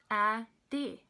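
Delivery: "Addie" slow, in two separate beats; chin-drop demo of each syllable in "Addie"; sounded out by syllable. Shown as a demonstration. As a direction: say "Addie" as Ad, pause, dee. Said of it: "by the movement of your chin".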